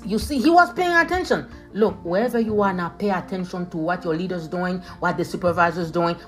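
ordinary talk, nothing marked

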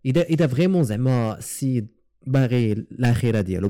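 ordinary talk, nothing marked

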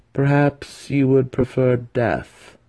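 sly apprentice offering death slowly; menacing threat. Acted. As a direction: weigh each word as a threat.

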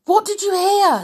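high, loud, eager question. A narrator's reading. Jack's eager question to the deaf old caretaker, excited.

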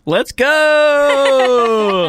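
excited cheer. Real, not acted. Cheering for favorite show.

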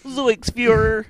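high, loud, slow Shaggy yell; drawn-out goofy cartoon shout. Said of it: "something really funny that Shaggy would say".

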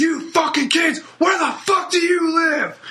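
high mock yell at kids; furious driver shout. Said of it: "And he was like".

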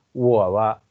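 ordinary talk

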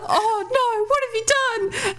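a panicked lover's gasp, overplayed; mock horrified Martin. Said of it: "Martin walks in and he's like".

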